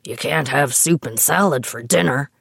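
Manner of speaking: mock the outraged dad complaining about dinner; lightly voiced indignant old father; grumpy father. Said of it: "Ted would have said".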